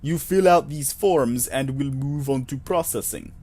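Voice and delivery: slow, put-on Russian accent; faux Russian accent. Clerk sending visitor to work, slow faux Russian accent. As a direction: instruct slowly in a fake Russian accent. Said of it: "a, faux Russian accent".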